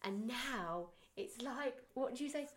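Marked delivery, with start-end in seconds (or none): none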